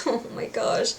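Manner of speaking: breathless whispered oh my gosh; soft unvoiced gasp of emotion; whispered awe. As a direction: whisper it, moved to tears. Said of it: "And I was like".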